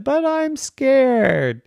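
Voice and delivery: high, slow frightened whine; scared child. Acted. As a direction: plead it slowly like a frightened kid. Daphne tells friends her fear.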